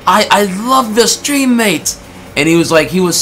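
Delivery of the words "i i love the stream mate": high-pitched, gushing British fan impression; hammed-up British accent, pitched way up; British or Australian accent. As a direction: put on an excitable Aussie-or-Brit "mate" accent. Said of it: "a British accent or whatever. It could be Australian".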